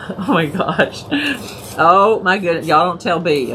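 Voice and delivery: ordinary talk, nothing marked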